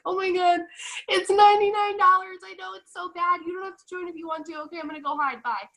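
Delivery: high-pitched hammed-up panic, loud then fading; mock nervous. Seller admitting the price to a prospect, mock nervous. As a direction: blurt it out in pretend panic, then shrink away. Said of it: "you're, like, kinda nervous".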